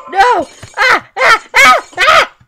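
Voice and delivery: loud high panicked yells; real startled screams; screaming. Real, not acted. Game character falling.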